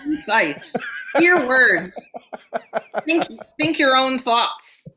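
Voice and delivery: newsreel announcer. Acted. Imitates announcer bit.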